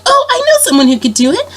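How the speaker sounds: very high sweet princess offer. Retold or imitated. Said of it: "she's like".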